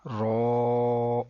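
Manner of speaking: رو with a rounded vowel held level; elongated letter name. Shown as a demonstration. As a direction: sustain رو evenly. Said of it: "لمبا کریں گے". (رو لمبا کر کے)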